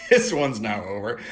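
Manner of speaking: loud laughing burst. Real, not acted. Vlogger cracking up after her Southern accent bit.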